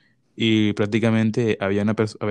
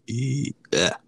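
voice cracking; 2 semitones higher than usual. Real, not acted. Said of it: "ahí se me sale un gallo".

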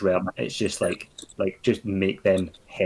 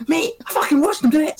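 much higher, louder exasperated insistence; exasperated repeat; 6 dB louder than usual. Retold or imitated. Repeating the accusation to doubting crewmates, much higher and louder. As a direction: blow up the second time you say it. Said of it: "I'm like".

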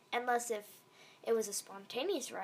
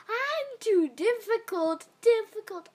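exaggerated mocking; 9 semitones higher, 7 dB louder than usual. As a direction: stretch it out to tease.

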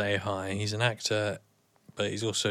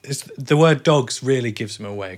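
ordinary talk, nothing marked

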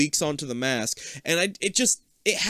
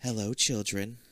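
robot voice; 7 semitones lower, 7 dB quieter than usual. Retold or imitated. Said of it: "Ava's voice comes through".

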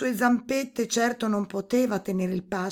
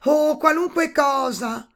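high, loud, drawn-out princess; promising princess; 8 semitones higher, 9 dB louder than usual. A narrator's reading. Princess promising the toad any reward, eager high voice. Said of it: "promise la principessa".

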